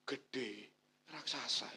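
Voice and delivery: mock cowed whisper about the giant; cowering fearful. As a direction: shrink back in fear. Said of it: "Jangan malah gini".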